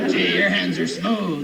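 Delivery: slow, leering tough-guy voice; leering inmate. Acted. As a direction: creep on the new prisoner slowly. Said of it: "those guys will put you to work".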